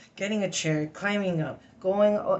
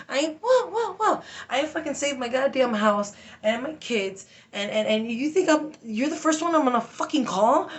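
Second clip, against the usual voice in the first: high, loud, raging shouts with tic yelps; furious shouted outburst; 9 semitones higher, 5 dB louder than usual. Retold or imitated. Retelling her rant at the DCF worker, shouting furiously. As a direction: scream at them how dare they call.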